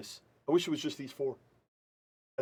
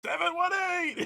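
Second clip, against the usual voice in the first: high-pitched imitated yell; mock holler, pitched way up; mom's yell; 12 semitones higher than usual. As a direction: call it out like an excited mom. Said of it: "And she yells".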